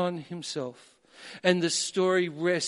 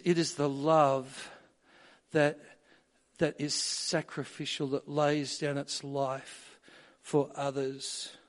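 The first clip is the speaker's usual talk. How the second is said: usual speaking voice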